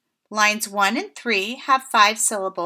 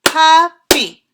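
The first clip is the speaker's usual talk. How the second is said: loud, higher, each syllable punched apart; clapped syllables; 4 semitones higher, 10 dB louder than usual. Shown as a demonstration. Teacher clapping out a word with her class, loud and syllable by syllable. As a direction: sound out PUP-PY for the class to clap along. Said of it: "let's say the word puppy together and clap".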